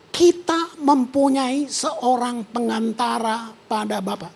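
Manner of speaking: slow, each word weighed; slow emphatic reading. Real, not acted. Preacher stressing a verse to the congregation, slow and weighty. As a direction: let every word of the verse land.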